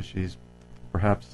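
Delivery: normal talking voice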